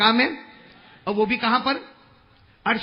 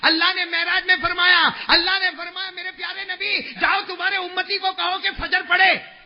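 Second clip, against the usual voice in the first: higher, proclaiming; lightly raised divine voice; 9 semitones higher, 6 dB louder than usual. Acted. Preacher voicing God's command to Prophet, raised. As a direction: announce it upward.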